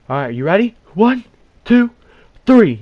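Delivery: high, loud yell through a door; shouted countdown. Retold or imitated. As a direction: imitate a rescuer calling the count. Rescuer's countdown called through door.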